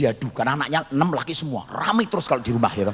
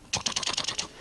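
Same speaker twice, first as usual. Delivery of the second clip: whispered clicking noise; soft chopstick clacking; 9 dB quieter than usual. Retold or imitated. Grandfather eating from his bowl, whispered clicks. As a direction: tap it out under your breath.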